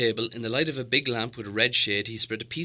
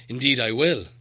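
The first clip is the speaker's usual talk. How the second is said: louder, firm dwarf lord; 3 semitones higher, 7 dB louder than usual. Acted. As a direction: agree curtly. Thorin accepts the key.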